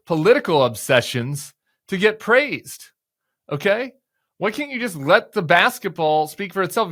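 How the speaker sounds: loud scolding, fired up; angry rant. Real, not acted.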